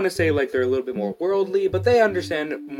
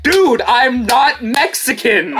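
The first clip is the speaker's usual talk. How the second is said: slow, higher, loud laughing protest; mock angry; 9 semitones higher, 8 dB louder, much slower than usual. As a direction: snap at him half laughing. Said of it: "I actually did that".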